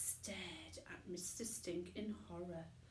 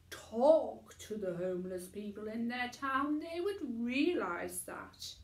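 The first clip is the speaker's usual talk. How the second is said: louder, earnest speech to the crowd; a tramp making his case on TV, a bit louder; lightly voiced Mister Stink; 2 semitones higher, 8 dB louder than usual. A narrator's reading. Tramp speaking out on a TV show, earnest and louder.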